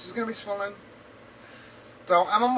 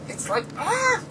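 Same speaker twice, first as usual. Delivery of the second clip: exasperated cry; 13 semitones higher, slower than usual. Real, not acted. Frustrated about growing her beard.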